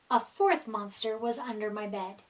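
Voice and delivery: plain reading voice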